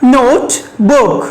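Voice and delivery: Note and book as two separate units; syllable by syllable. Shown as a demonstration. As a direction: pause between "Note" and "book". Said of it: "There are two syllables here".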